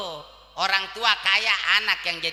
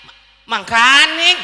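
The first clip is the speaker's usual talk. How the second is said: much louder, slow and rising; drawn-out yell; 4 semitones higher, 12 dB louder, slower than usual. Acted. Repeating the word in the gas-stove story, drawn-out yell. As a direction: stretch the word into a loud rising call.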